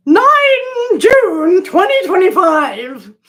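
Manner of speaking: high, loud, slow dramatic dictation; mock scientist log. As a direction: announce the entry like a crazed explorer. Mock field notes entry.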